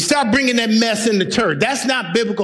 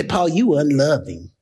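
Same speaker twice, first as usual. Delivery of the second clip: lower, sing-song mock of worldly believers; mocking the soft crowd; 6 semitones lower than usual. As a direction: imitate them like a whining crowd. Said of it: "Today's people would say".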